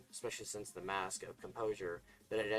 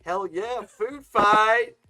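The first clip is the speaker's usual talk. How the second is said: high, loud gleeful yell; excited shout; 12 semitones higher, 17 dB louder, much slower than usual. Acted. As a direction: holler it with glee. Starting a food fight.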